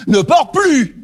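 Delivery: high, sharp command; stern order. Retold or imitated. Preacher voicing what Jesus did not say, sharp. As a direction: snap it like a strict order. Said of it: "Il ne lui dit pas non plus".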